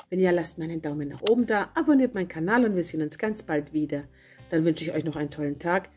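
usual speaking voice

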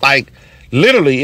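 ordinary talk, nothing marked

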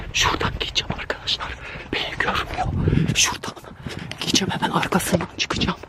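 tense breathy whisper while hiding; scared whisper. Real, not acted. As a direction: whisper so it won't hear you. Sneaking behind it in hiding. (saklanırken korkulu fısıltı)

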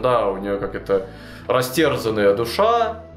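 normal talking voice

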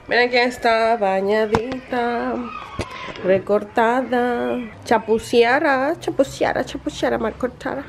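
drawn-out singsong pet voice; cutesy voicing for a pet; baby talk. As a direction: tease with babyish, mushy consonants. Cooing over and voicing groomed pet.

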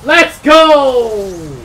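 long, high, very loud cheer; triumphant shout. Real, not acted. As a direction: bellow it in celebration, stretching the last word. Celebrating in game.

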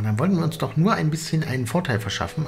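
ordinary talk

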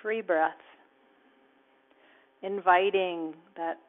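calm guided relaxation cue; guided meditation. Acted. Guides relaxation and loving kindness.

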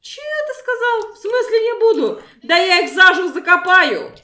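angry granny. Pretend. Granny furious at player.

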